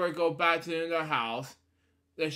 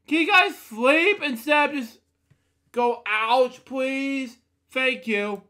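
higher, louder pleading to the room; raised exasperated request; exasperated plea; 8 semitones higher, 6 dB louder than usual. Real, not acted. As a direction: beg them to stop, fed up. Reader scolds listeners mid-story.

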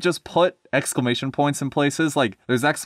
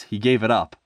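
ordinary delivery

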